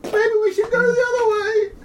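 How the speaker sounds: high, crying plea; scared whimper. Acted. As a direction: whine it out in fear. Scared character line.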